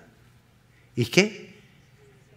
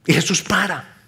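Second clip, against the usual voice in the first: usual speaking voice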